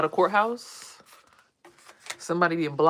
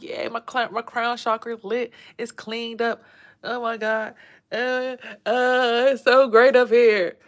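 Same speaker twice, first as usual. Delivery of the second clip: excited; 7 semitones higher, 6 dB louder, slower than usual. Retold or imitated. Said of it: "I'm like".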